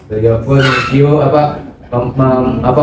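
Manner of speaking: normal talking voice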